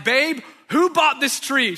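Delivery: high, loud, drawn-out mock outrage; losing it. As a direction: act out losing it at your wife, slow and loud. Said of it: "I have lost it".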